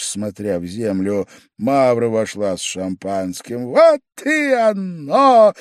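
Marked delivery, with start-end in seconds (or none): 3.7-5.5 high, loud, drawn-out cry; relieved shout. A narrator's reading. Pavel Pavlovich crying out as the champagne arrives, high and drawn out. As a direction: pounce on the rescue. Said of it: "закричал Павел Павлович".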